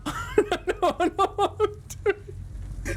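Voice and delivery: very high, stretched-out squeal; slow, high-pitched mock protest. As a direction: drag it out in a laughing squeak. Mock protest at being told.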